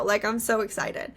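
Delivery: ordinary talk, nothing marked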